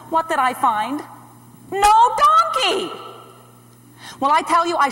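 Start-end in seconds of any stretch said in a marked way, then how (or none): 1.7-2.9 high, loud and drawn out; slow, high exclamation of dismay; outraged cry. Acted. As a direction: wail it in outraged alarm. Finds the donkey gone.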